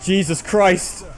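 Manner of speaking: loud, high and thrilled; excited shouting. Real, not acted. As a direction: cheer them on loudly. Units charge, streamer cheers them on.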